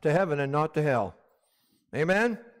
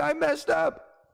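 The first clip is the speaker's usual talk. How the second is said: mock whine; 8 semitones higher, slower than usual. Congregant's inner voice quoted.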